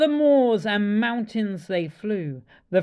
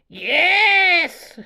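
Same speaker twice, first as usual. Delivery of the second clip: glad louder yes; cried yes; 8 semitones higher, 5 dB louder than usual. Acted. Witch welcoming the bird, glad cry. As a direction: welcome her aboard with a cry. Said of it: "cried the witch".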